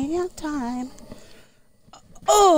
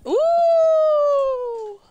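high ooh; 13 semitones higher, 5 dB louder than usual. Real, not acted. Sudden exclamation.

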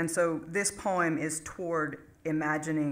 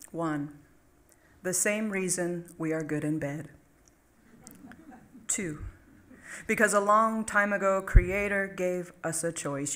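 comic poem recitation; 3 semitones higher, 4 dB louder than usual. Acted. Poet reading a humorous top ten to an audience, emphatic recitation. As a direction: list poem read out with announced numbers and pauses.

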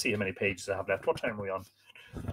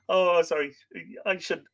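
ordinary delivery

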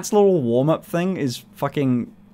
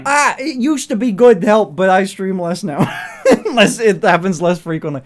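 laughing; 4 semitones higher, 5 dB louder than usual. Real, not acted. Laughing while explaining.

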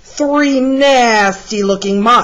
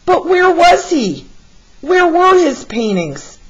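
wondering aloud; 9 semitones higher than usual. A narrator's reading. Waiting crowd wonders.

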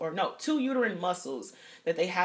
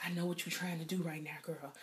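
knowing mutter; 2 semitones lower, 10 dB quieter than usual. Retold or imitated. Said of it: "I was like".

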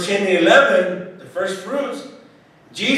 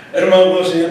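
ordinary talk

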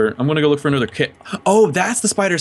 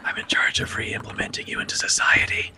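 unvoiced, creepy caretaker whisper; 12 semitones higher than usual. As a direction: whisper it like a sinister guardian. Creepy whisper role-play.

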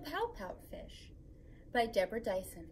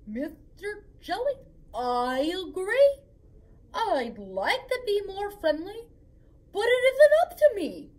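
rising to a louder, higher wail; whiny fish; 7 semitones higher, 11 dB louder than usual. Acted. Fish protesting to the jellyfish, building to a loud whine. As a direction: complain you can't help it.

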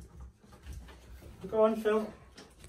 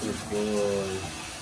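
calm low praise; 6 semitones lower, 6 dB quieter, slower than usual. Real, not acted.

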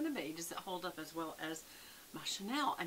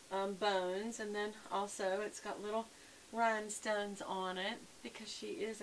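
usual speaking voice

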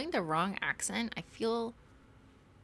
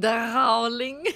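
"Darling" with a rolled French R, loud and higher; 4 semitones higher, 10 dB louder than usual. Acted. Showing off the lovely French accent, rolled R. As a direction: roll the R in a hammed-up French "Darling", laughing. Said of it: "See, they roll the R's".

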